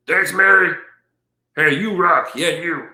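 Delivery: high, bright voicing of the pet dog thanking a donor. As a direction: speak for the dog in a high, playful voice. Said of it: "Gotta say thank you".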